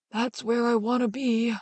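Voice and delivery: put-on slow old man's voice, lightly done; old drover. A narrator's reading. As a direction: say it slowly like a weary old man.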